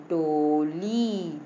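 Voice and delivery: डोली with both syllables held long; taking time. Shown as a demonstration. Teacher shows children the word takes time, drawn out. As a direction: stretch out डोली very slowly. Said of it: "इसको भी बोलने में time लग रहा है".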